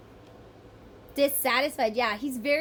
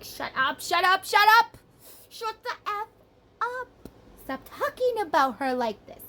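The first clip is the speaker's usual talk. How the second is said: yelled, much higher and louder shut up; exploding in anger; 9 semitones higher, 6 dB louder than usual. Retold or imitated. Man fed up with talk about her, shouting angrily. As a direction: blow up and shout them down. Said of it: "He might actually explode".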